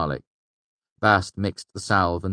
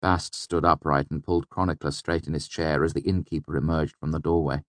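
ordinary delivery, nothing marked

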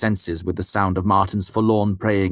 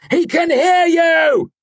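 slow, frantic bellow, much higher and louder; mad shout; 7 dB louder, slower than usual. A narrator's reading. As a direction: scream the warning madly. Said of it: "I shouted madly at Martin".

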